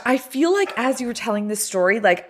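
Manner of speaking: ordinary delivery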